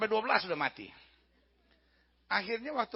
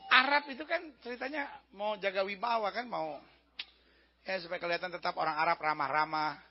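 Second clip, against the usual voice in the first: ordinary talk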